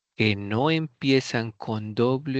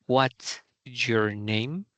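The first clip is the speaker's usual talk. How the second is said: strong start on What's, slowly fading to the end; slow demo of a WH question falling off; falling question tune; much slower than usual. Shown as a demonstration. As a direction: hit What's hard, then let the voice sink. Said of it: "What start strong? And you finish a weaker".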